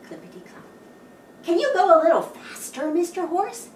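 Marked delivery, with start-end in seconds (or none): 1.4-3.7 high, fast eager plea; eager ask. Acted. Animals urging the horse on, high and fast. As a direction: rush it out excitedly. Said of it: "ask Cat and Dog".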